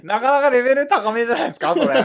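loud laughing reaction. Real, not acted. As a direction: laugh it out, impressed.

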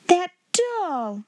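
high, outraged exclamation; indignant cry. A narrator's reading. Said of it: "cried Miss Minchin".

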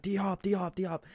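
high-pitched call, volume held back; mock yell. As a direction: imitate calling out for a player's attention. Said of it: "I'm yelling at DeAndre Hopkins".

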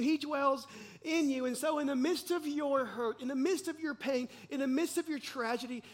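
loud, high, driving preacher voice; preaching at full force. Acted. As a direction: build it up, preaching hard.

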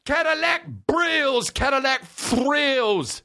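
hammed-up shocked star. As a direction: protest like a spoiled rapper. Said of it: "he's like".

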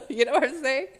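words through high giggles; giggling through the wrap-up; laughing. Real, not acted. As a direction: talk while still laughing. Laughing after the joke.

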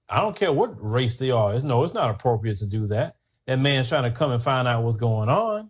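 normal talking voice

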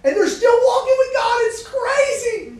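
bursts out high and loud, laughing; laughing excitement. Real, not acted. Preacher to students, marveling at friends still walking with God, laughing shout.

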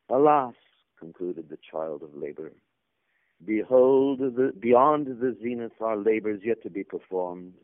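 grand, declaiming ritual reading; solemn scripture reading. Acted. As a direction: proclaim it like ancient scripture. Reads a solemn allegory aloud.

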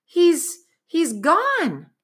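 high, stammering astonishment; boy finding the porthole empty; stunned. Acted. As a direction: stumble over it in shock. Boy stunned pig vanished.

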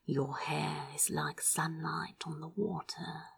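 hushed adoring whisper. A narrator's reading. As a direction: whisper it close to his neck. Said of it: "he whispered".

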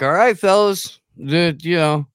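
high, loud call to the troops; mock battle cry to the fellas; rallying shout. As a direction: rouse the men like a commander.

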